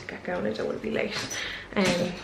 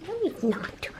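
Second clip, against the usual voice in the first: much higher sing-song answer for the dog; 12 semitones higher, slower than usual. Real, not acted. Owner answering for dog Bobby, high baby talk. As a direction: coo it in a squeaky puppy voice.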